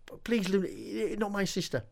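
frightened pleading. Acted. Dave begs the witches.

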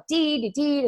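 high garbled babble; garbled speaker sound. Retold or imitated. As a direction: mimic a broken speaker. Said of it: "So she was like".

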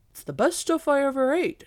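high, loud character voice; impressed Mister Chu. Acted. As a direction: exclaim it like a well-fed guest. Mister Chu exclaims over dinner.

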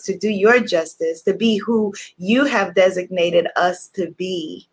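measured devotional prayer. Acted. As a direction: pray with reverence. Leads a prayer.

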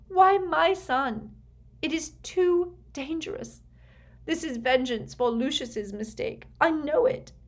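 high, distraught mother's protest; crying in earnest. A narrator's reading. Weeping mother beseeching Snape about her son, distraught. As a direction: plead through tears, voice pitched well up. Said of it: "Narcissa began to cry in earnest".

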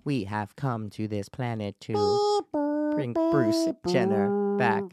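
slow, distorted alien voice. Acted. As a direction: speak like a visiting alien, drawn out. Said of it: "best alien sound right now".

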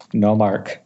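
normal talking voice

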